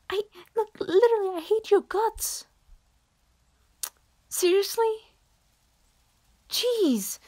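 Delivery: high, loud furious shout; furious outburst. Acted. Telling the admirer she hates his guts, high and furious.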